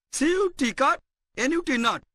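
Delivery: ordinary delivery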